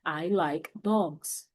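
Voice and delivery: very slow, careful English with "dogs" falling at the end; slow classroom model of the correct sentence. Shown as a demonstration. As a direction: read out the right example slowly for students. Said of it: "voy a utilizar ese sustantivo directamente".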